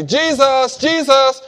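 loud, high repeated call; crying out. Acted. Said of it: "continually kept saying".

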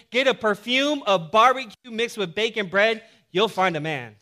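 loud, high, driving sermon delivery; full-force preaching. Acted. Pastor preaching to his church, loud and driving. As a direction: preach it big to the congregation.

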